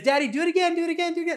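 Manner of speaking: put-on very high, pleading child chant; a little girl chanting at her dad for another spin; little daughter's voice. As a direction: beg over and over like a small girl. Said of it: "And it's".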